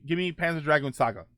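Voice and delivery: ordinary talk, nothing marked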